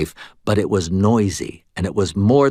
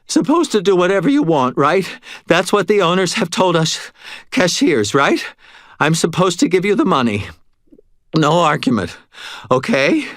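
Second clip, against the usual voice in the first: higher, louder frantic near-sob; near sobbing; 9 semitones higher, 4 dB louder than usual. A narrator's reading. Terrified cashier near tears pleading with the robber. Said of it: "He was practically sobbing".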